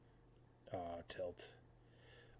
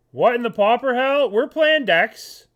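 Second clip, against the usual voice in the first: shocked outburst; 7 semitones higher, 8 dB louder than usual. Real, not acted. Shocked at opponent's deck.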